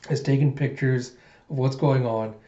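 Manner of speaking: ordinary delivery, nothing marked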